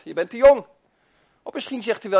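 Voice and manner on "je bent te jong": loud scornful taunt as the devil; devil taunting loudly. Acted. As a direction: shout it down at little David. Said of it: "Zei hij tegen kleine David ook".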